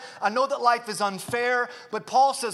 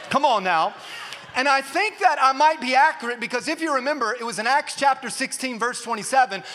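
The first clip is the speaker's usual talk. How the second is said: ordinary delivery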